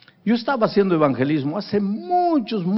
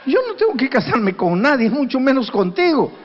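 sorpresa indignada; 7 semitones higher than usual. Retold or imitated.

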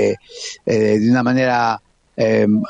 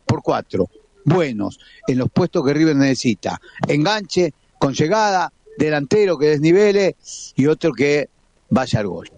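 ordinary talk, nothing marked

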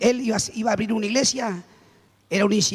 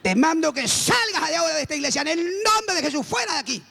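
higher, forceful casting-out command; commanding exorcism; 5 semitones higher than usual. Retold or imitated. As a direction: banish them with rising authority. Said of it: "ya tenía la autoridad".

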